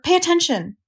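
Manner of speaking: high, urgent command; restrained scream for attention; mild scream. A narrator's reading. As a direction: cry it out high and insistent. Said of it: "screamed Wilbur".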